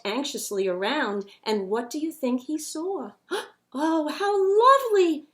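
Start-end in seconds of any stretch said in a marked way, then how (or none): 3.3-5.3 gasp, then high, louder lovely; breathless wonder at the flowers; amazed gasp. A narrator's reading.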